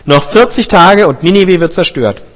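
slow, loud proclamation to the whole city; calling out. A narrator's reading. Jona calls out his warning to Ninive: slow, loud proclamation. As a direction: cry the warning out slowly and loud, like a herald. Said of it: "er rief und sprach".

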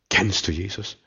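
soft, restrained appeal; hushed, probing; quiet searching question. Real, not acted. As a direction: drop the volume and ask sincerely. Preacher turns question on listeners.

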